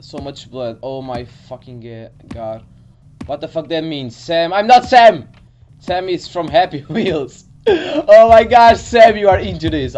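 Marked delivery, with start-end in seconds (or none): 4.1-5.3 loud, raised-pitch objection; indignant outburst, voice jumping up; indignant protest. Real, not acted. Protests the name the game gives. 7.7-9.9 loud, high cry of shock; shocked outburst, pitched up and very loud; startled outcry. Real, not acted. Startled outburst at finding Sam.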